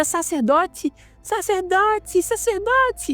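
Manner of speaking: put-on girl screaming after the leaving priest, rising; desperate calling. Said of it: "ela grita".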